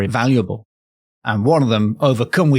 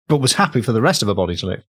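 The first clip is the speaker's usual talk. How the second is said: ordinary delivery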